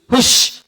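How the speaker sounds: unvoiced "hush" to chase a chicken; shooing hiss. Shown as a demonstration. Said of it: "diusir tuh". (usir ayam dengan desis "hush")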